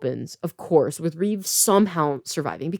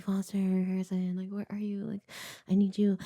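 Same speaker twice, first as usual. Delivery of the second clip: soft frightened murmur; a hushed, pleading call for friends; scared mutter; 10 dB quieter than usual. Retold or imitated. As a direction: mutter the names under your breath.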